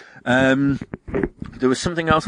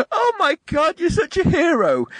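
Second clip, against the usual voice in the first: very high, drawn-out squeal of adoration; hammed-up weepy parent worship; mock tearful gushing; slower than usual. Parents seeing the Hoff at the hospital, mock tearful gushing. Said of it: "they see him start crying".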